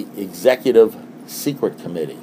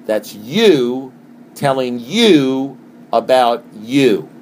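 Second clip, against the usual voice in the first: very slow, every word weighed; slowed-down preacher's emphasis; slow emphatic; much slower than usual. Acted. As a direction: drag it out for weight. Slow emphatic repeat of point.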